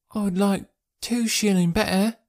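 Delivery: put-on slow, high child voice; thoughtful small lad, voice pitched high; little boy. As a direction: pipe it up slowly like a six-year-old.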